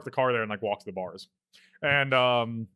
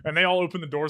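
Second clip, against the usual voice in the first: ordinary talk, nothing marked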